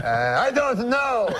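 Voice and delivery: put-on slow, high French-Canadian accent; drawn-out puzzlement in a French-Canadian accent; French-Canadian uncle. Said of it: "my uncle goes".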